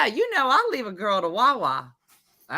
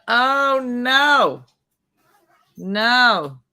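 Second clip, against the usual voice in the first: loud, higher "oh, no"; mock scolding; 5 semitones higher, 6 dB louder, much slower than usual. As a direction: shut the kid down loudly, drawn out. Acting her scolding of the kid.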